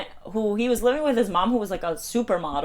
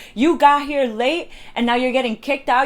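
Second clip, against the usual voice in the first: raised-voice telling-off, retold; mock yelling; 5 semitones higher, 5 dB louder than usual. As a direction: imitate a scolding woman, high and loud. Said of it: "this woman's, like, yelling at me".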